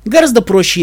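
ordinary delivery

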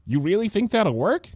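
high-pitched hopeful question; eager, lifted voice. A narrator's reading. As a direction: brighten up with sudden hope. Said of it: "face lighting up".

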